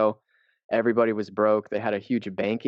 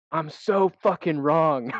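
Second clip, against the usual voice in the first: mock shout; 6 semitones higher, 3 dB louder, slower than usual. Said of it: "and I went".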